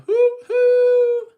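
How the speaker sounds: very high loud hoot; train whistle. Acted. Train blowing its whistle, loud high hoot. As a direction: toot like a steam whistle.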